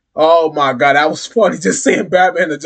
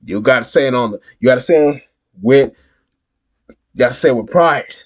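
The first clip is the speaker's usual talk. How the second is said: ordinary talk, nothing marked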